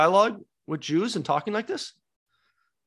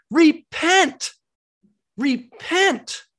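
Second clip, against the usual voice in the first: forceful higher Repent, hammered down; stern call; 9 semitones higher, 4 dB louder, much slower than usual. Acted. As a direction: command them to turn around. Peter's real call quoted.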